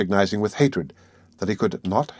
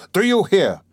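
high, raised angry shout; 10 semitones higher, 5 dB louder than usual. A narrator's reading. Suspect banging the table at the lawyer, shouting. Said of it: "he shouted".

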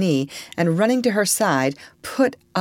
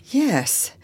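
higher, unhurried yes; slow answer; 8 semitones higher than usual. A narrator's reading. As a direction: answer slowly and deliberately. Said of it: "said Eliza slowly".

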